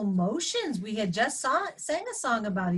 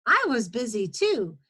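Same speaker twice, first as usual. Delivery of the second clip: storytime narration for first graders; reading to children; 3 semitones higher than usual. A narrator's reading. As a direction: read it as a guinea pig's tale. Reads picture book aloud.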